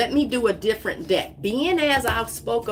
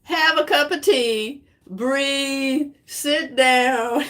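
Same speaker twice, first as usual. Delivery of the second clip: higher, drawn-out singsong instructions; playful, lilting, raised pitch; mock soothing advice; 5 semitones higher, 4 dB louder, slower than usual. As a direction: tell them to settle down, stretching each phrase. Voices the card's advice, amused.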